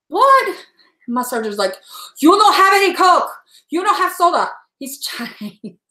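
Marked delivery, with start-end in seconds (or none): 0.1-0.5 high, loud "what?"; surprised. Retold or imitated. Patient taken aback by the surgeon's rule, surprised. Acts out surprised reaction to doctor. 2.2-4.5 higher, louder imitation of a Chinese-accented surgeon. Surgeon forbidding soda to a patient, imitated Chinese accent. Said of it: "I do that accent".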